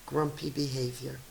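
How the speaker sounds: ordinary delivery, nothing marked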